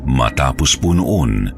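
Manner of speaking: plain reading voice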